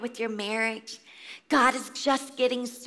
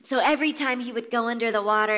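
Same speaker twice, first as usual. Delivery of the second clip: ordinary talk, nothing marked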